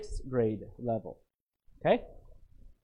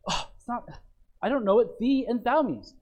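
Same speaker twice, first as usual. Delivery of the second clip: mock confused reader; 7 semitones higher than usual.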